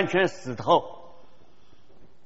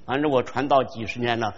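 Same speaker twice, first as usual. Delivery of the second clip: ordinary talk, nothing marked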